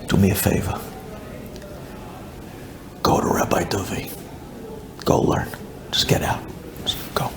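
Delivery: low, nearly whispered request; hushed pleading. Retold or imitated. Wife begs husband to go learn, hushed. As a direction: plead wearily under your breath.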